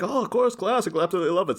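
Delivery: high-pitched, quick mock gushing; gushing guests. Co-host imitates the expected guests praising a classic, mock gushing. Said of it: "come on and be like".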